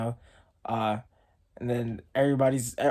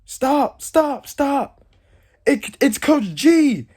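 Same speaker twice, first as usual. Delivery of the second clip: much higher, louder frantic shout; yelling stop; 11 semitones higher, 6 dB louder, much slower than usual. Retold or imitated. Player shouting to stop the beating, higher and louder. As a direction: yell to halt them, slowing on the name. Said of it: "somebody yells out".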